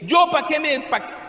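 mock complaint. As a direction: play a fed-up parishioner, high and loud. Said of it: "Hay gente que suele decir".

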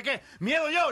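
very high, loud indignant cry; outraged retort. Acted. Little soldier outraged at being called a coward: high loud retort. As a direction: fire it back, offended.

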